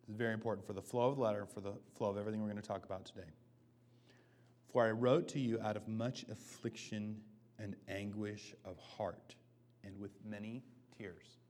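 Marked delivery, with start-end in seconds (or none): 4.7-11.4 slow solemn scripture reading. Acted. Pastor reading Paul's anguished verse, slow and fading. Reads verse four slowly.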